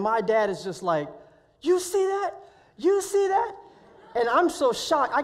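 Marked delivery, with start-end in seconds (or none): 1.6-3.5 high, loud, thrilled repeat; excited shout. Retold or imitated. Dad to his son as the eagle glides in, thrilled shout. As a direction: point and shout in amazement.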